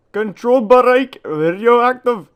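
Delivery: very loud, very high, slow hammed-up German accent; drawn-out, booming mock German. Reading a radioactive area sign, very loud slow mock German accent. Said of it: "sorry for my terrible German accent".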